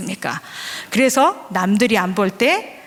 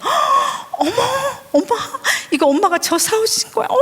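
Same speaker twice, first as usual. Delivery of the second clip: put-on high squealing little girl's delight; gasping delight; 13 semitones higher than usual. Mother imitates daughter's high squeal over the snack. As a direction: gasp and squeal like a thrilled little girl.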